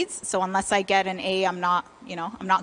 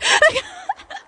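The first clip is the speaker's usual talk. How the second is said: high laugh; 4 dB louder than usual. Real, not acted. Laughing at own story.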